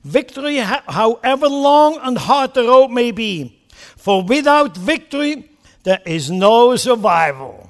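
Churchill quote. Retold or imitated. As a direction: proclaim victory like a wartime leader. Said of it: "He goes on".

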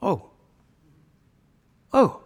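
normal talking voice

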